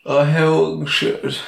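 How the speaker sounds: ordinary talk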